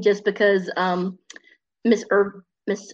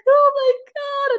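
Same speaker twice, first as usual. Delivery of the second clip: very high, slow drawn-out mock wail; 3 dB louder, slower than usual. Mother sees her son is a mouse, high slow wail. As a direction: stretch it out like a grieving mother. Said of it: "She's like".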